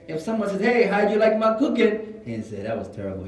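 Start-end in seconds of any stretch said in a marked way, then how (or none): 0.6-2.0 loud, high, upbeat question; eager host. Acted. Someone fishing for praise of their cooking, eager. 2.6-3.3 blunt verdict. Acted. Acts a blunt guest's answer.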